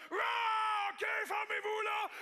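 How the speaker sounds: high, loud exasperated shout; mock shout. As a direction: yell at them to shut up. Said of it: "il a fait comme". (cri exaspéré aigu)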